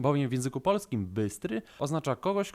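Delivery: ordinary talk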